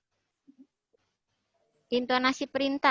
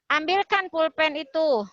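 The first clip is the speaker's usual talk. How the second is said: "ambilkan pulpen itu" pitched up like an order; raised command intonation; 6 semitones higher, 4 dB louder than usual. Shown as a demonstration. Teacher repeating an example order to the class, raised command tone. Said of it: "Nah, agak naik".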